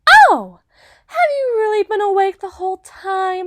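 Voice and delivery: high, loud feigned gasp of surprise; mock surprise. Hunter feigning surprise that his captive is awake. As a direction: act startled, theatrically.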